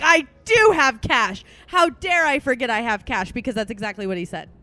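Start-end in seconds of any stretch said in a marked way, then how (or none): 0.0-3.3 hammed-up shocked discovery; excited. Retelling the cash moment, loud mock shock. Mocking own realization.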